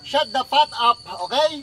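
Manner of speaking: loud, fed-up yell; angry shout. Real, not acted. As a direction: shout them down. Telling friends to shut up.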